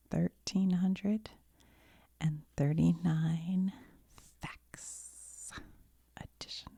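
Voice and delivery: slow, breathy ASMR whisper. Real, not acted. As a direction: whisper the show title gently.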